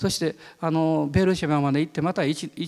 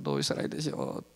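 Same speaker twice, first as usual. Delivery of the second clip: much lower, softer trailing repeat; fading prayer; 9 dB quieter, faster than usual. Retold or imitated. Preacher repeating his plea to God, fading softly. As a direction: let the plea fade away. Praying in patrol car.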